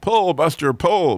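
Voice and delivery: high, loud holler. Acted. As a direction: call out to the horse with full voice. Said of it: "the farmer hollered".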